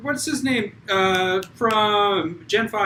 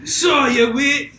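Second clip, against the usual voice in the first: louder, shouted mock Scottish taunt; Scottish accent; 3 semitones higher, 3 dB louder than usual. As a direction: taunt in a hammy Scottish brogue. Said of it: "they have a Scott off".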